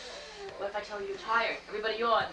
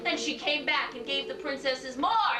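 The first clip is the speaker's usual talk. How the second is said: ordinary talk, nothing marked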